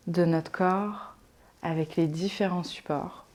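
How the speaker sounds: soft, calm relaxation voice with long pauses; guided meditation. Acted. Yoga teacher settling listeners into Yoga Nidra, calm guided meditation. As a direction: ease them gently into rest, leaving space to breathe.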